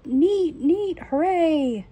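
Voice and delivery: high, loud mock cheer, slow on the second neat; imagined shout. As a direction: cheer it out like the father would. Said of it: "he will shout".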